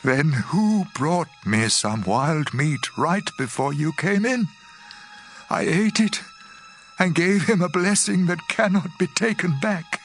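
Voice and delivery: mildly shaken, voice climbing in pitch; a father in rising alarm; trembling father. Acted. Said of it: "Isaac started trembling and said".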